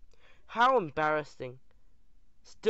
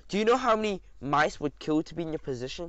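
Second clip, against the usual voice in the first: ordinary delivery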